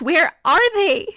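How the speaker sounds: high, loud, slow screech. Acted. Injured woman demanding where family is, screeching. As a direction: screech the question in panic. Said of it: "I screeched".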